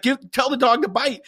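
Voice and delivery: high-pitched imitation of an urgent colleague; urgent prodding. Colleague urges handler during the chase, high urgent prodding. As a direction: act out pressing someone to hurry.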